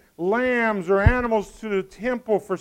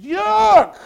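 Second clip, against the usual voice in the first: very loud, high yelp; sudden scream; 11 semitones higher, 11 dB louder than usual. Acted. Passenger suddenly screaming in the car, startling yell. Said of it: "in your car screams".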